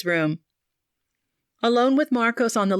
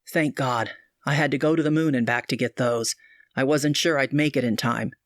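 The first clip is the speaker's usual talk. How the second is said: lower, earnest man's voice; doctor Marcos, urgent; 6 semitones lower than usual. A narrator's reading. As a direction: press the warning, low and serious.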